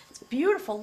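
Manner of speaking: excited. Real, not acted. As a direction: gush over something beautiful. Overwhelmed by the card image.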